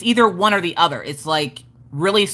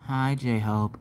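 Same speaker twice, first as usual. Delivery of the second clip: low, soft, lifeless greeting; monotone; 8 semitones lower, 9 dB quieter than usual. Shown as a demonstration. Mocking a dull greeting to a viewer, low monotone. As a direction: drone the hello in deadpan. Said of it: "how monotone your ass is".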